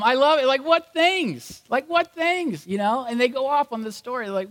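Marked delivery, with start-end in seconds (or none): 0.6-2.7 high, slow mock-innocent question; playing dumb. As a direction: feign total cluelessness, drawing it out. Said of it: "He plays dumb".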